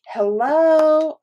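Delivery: hello sung out high and loud; excited hello. Real, not acted. Repeats it even higher.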